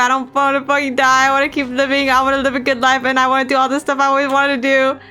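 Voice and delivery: frantic, begging for mercy; terrified young man pleading; pleading in terror. Acted. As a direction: beg for your life, panicking.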